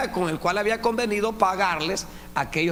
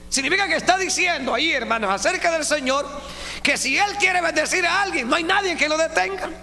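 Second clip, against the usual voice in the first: high, loud fervent preaching; full-force preaching; 10 semitones higher, 4 dB louder than usual. Acted. Preacher urging the congregation, high and loud. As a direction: preach it out with fire.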